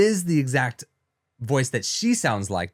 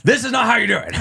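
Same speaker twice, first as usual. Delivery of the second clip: loud, raised-pitch ranting impression; Alex Jones; 11 semitones higher, 9 dB louder, faster than usual. Imitating a host losing his company over conspiracy, loud rant. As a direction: bellow it like a mock rant. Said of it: "Alex Jones is like".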